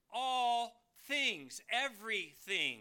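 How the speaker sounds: "all things" loud and high, "everything" weighty; emphatic stress. Shown as a demonstration. Preacher showing what Peter put up front, emphatic stress on "all things". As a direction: punch "all things", each word set apart. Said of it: "he's stressing this point".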